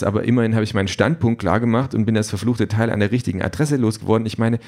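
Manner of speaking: fast, rushed rambling; nervous rambling after a scare; babbling. Acted. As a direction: babble on without a breath. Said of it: "Sofort fängt er an zu brabbeln".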